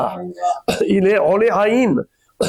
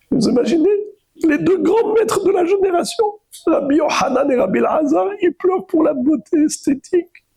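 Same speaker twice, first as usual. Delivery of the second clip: high-pitched, astonished objection; incredulous; 10 semitones higher than usual. Acted. Teacher voices the commentator's shock at two great masters weeping, incredulous. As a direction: voice climbs high in disbelief.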